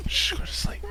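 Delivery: soft unvoiced lullaby whisper; whispered hush. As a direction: whisper it like soothing a child. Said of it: "and you're like".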